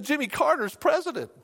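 very high, fretful alarm; retold panic. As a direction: imitate a caller dreading the news. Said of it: "she was just panicked".

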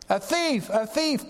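high, alarmed outcry; crying out. Acted. Clock collector cries out at the thief, alarmed and high. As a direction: cry it out high, caught off guard. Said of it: "cried the, the rotund clock collector".

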